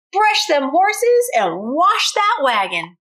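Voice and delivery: wicked stepdaddy. Acted. Wicked stepdaddy ordering Bubba around, mock mean. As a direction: bark it down at the boy. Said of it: "shouted his wicked stepdaddy".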